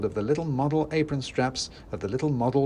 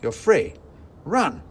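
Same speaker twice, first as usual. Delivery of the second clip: put-on louder, higher shout to the kids; 6 semitones higher, 6 dB louder, slower than usual. A narrator's reading. Narrator shouting at the freed kids to run. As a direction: yell it to send them running. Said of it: "I shouted".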